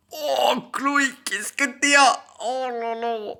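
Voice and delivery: high, drawn-out wail of dismay; exaggerated dismay. Real, not acted. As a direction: moan it high, appalled by what you read. Cringing at the story's spelling. (lamentation aiguë et exagérée)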